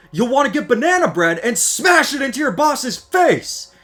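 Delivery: hammed-up fed-up worker's rant; mock outburst. Fed-up worker imagining revenge on the boss, hammed-up outburst. Comic outburst about a dull job.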